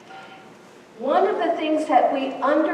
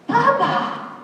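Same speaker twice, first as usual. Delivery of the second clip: put-on high, louder toddler exclamation; small child; 7 semitones higher, 4 dB louder than usual. Child naming the doggy to mother, high toddler voice. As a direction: blurt it out like a little child. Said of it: "And the child will say".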